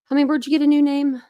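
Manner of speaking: light up at seeing a regular in chat; excited greeting. Real, not acted. Streamer greeting a viewer she spots in chat, excited.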